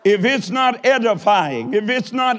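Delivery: emphatic pulpit exhortation; full-force preaching. Real, not acted. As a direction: build each clause and punch its last word.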